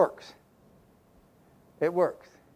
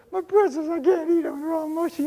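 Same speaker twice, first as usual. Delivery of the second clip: higher pitched whiny little boy voice, imitating his younger self; playing the upset kid over soggy pretzels; child voice; 7 semitones higher than usual. Retelling himself as crying child.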